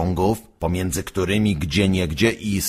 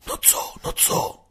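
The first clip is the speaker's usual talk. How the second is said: unvoiced eager whisper; curious whisper; 7 dB quieter than usual. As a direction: hush it out, dying to know. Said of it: "zaciekawiły się dzieci".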